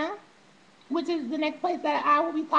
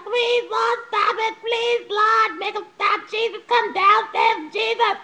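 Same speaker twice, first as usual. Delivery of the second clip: higher, louder hammed-up tearful plea; mock sobbing prayer; 5 semitones higher, 4 dB louder, slower than usual. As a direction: beg heaven like a bawling child. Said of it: "crying my fucking eyes out".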